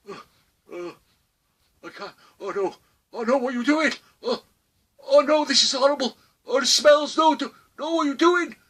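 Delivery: high, whiny, horrified pleading; disgusted panic. Acted. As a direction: beg them to stop in mock horror. Paralysed man being soiled.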